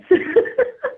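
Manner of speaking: short high burst of laughter; a quick, real, high laugh. Real, not acted. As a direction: laugh at the absurdity. Laughs at her own story.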